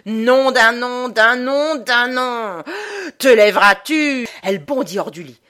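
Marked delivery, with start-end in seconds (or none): 0.1-4.3 thundering drowned-sailor voice, very loud; angry, high-pitched roar of command; furious roar. A narrator's reading. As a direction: bellow the oath, dragging it out. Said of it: "la voix furieuse, la voix de tonnerre".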